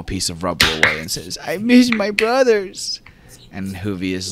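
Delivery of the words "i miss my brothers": put-on high, slow child's voice, missing his brothers; a small boy's sad admission. GM voices six-year-old Eli.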